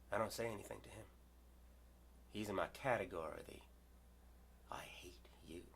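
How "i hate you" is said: hateful whisper. Retold or imitated. Voicing the man's hatred.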